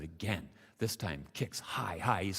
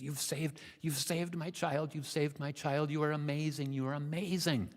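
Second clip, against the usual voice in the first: high, sustained gushing gratitude; frantic mother swallow; 6 semitones higher than usual. Acted. Mother swallow thanks the frog for saving her child, frantic. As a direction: pour out relieved thanks in a raised voice. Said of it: "the mother swallow is frantic".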